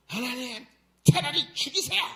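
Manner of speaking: high, loud outburst at God; imitating a sulking prophet's shrieked complaint; mock temper tantrum. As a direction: throw a hammed-up fit of protest. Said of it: "하나님께 막 성질을 내죠".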